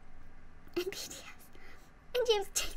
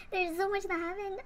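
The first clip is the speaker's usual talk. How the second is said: ordinary talk, nothing marked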